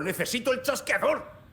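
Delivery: rising, agitated insistence; desperate demand. Acted. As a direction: press for it frantically. Man desperately demanding the clicker.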